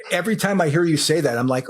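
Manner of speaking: ordinary talk, nothing marked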